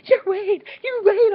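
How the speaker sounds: high, loud, thrilled cry of the name; excited fangirl squeal. Real, not acted. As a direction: shout his name, starstruck and overjoyed. Meeting her idol, fangirling.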